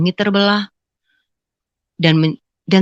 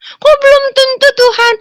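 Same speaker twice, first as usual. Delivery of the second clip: surprised protest; 5 dB louder than usual. Retold or imitated. Protesting God's unexpected answer.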